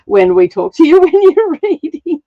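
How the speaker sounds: laughing through the words; breaking into laughter while quoting. Real, not acted.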